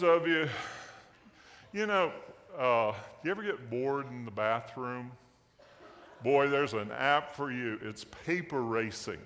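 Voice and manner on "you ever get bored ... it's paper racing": ordinary talk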